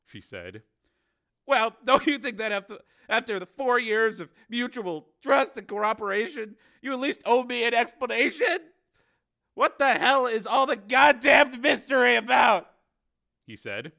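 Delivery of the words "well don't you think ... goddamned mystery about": loud, high-pitched indignant tirade; exasperated demand. A narrator's reading. Cameraman demands explanation from secretive partner, indignant and loud. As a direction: press angrily for an explanation.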